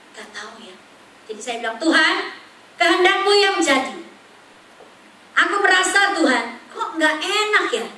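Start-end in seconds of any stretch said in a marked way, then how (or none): 1.8-7.9 loud, high pleading to God; loud high earnest plea; fervent prayer. Retold or imitated. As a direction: cry out the prayer with urgency. Retold urgent prayer.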